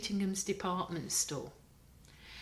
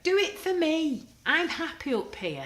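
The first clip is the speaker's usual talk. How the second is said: pleading fib; 11 semitones higher, 7 dB louder than usual. A narrator's reading. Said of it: "he fibbed".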